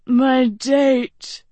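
sleepy yawning voice. Acted. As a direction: say it mid-yawn.

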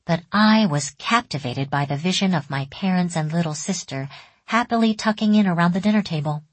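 plain reading voice

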